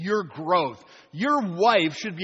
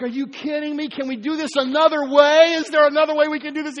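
exasperated protest; 5 semitones higher, 6 dB louder than usual. Retold or imitated. Believer protests to God.

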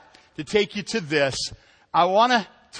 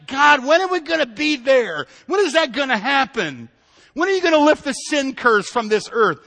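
higher, louder, pleading and impatient; impatient plea to God; 6 semitones higher, 5 dB louder than usual. Retold or imitated. Believer pleading with God to hurry, impatient cry. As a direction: beg God like a kid asking if we're there yet. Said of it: "It's like".

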